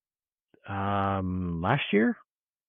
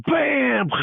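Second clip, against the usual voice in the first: sudden loud high "bam" imitating the crash; crash noise; 6 dB louder than usual. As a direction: slam the word out like an impact.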